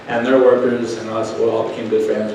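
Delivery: ordinary talk, nothing marked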